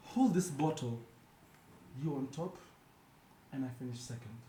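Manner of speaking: measured spoken-word delivery with long pauses; poetry recital. Acted. Host performs an unhinged poem for co-hosts, poetry recital. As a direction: deliver each short line as a separate beat.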